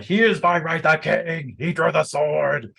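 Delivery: high, projected proclamation; shouting knight. Acted. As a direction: call it out like a knight rallying a crowd. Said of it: "Leonigrans is shouting from his saddle".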